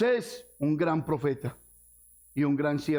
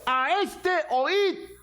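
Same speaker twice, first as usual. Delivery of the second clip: high, slow, solemn heavenly voice; 12 semitones higher, much slower than usual. Acted. The voice from heaven commanding the disciples, slow and solemn. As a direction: boom it down like God from the clouds. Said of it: "La voz que salía del cielo".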